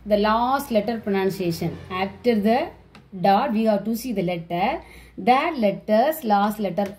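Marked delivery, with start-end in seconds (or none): none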